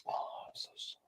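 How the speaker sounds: whispered fake sympathy; a hushed mocking condolence. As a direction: breathe it like a pitying friend. Mock sympathy continues.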